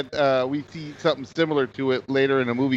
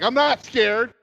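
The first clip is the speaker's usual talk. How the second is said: defensive; 9 semitones higher, slower than usual. Retold or imitated. Acting out a defensive denial.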